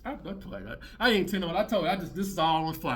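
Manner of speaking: ordinary talk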